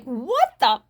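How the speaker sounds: aghast question to her mother; appalled disbelief. Retold or imitated. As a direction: ask it in open disbelief at the guy. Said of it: "looked at my mother like".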